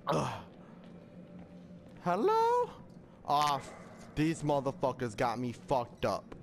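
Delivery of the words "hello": calling out. Real, not acted. Calling into dark building.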